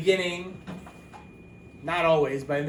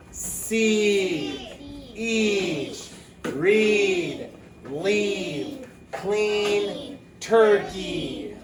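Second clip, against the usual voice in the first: choral reading lead; 4 semitones higher, much slower than usual. Shown as a demonstration. Teacher leads students reading along, calling words out. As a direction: lead the class word by word, bright and carrying. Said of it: "now you guys with me".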